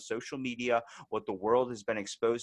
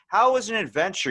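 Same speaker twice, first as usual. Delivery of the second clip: incredulous; 9 semitones higher, 7 dB louder than usual. Retold or imitated. Arguing with cousin about hospital.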